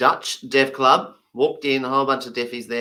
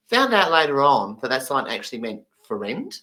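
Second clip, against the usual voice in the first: ordinary talk, nothing marked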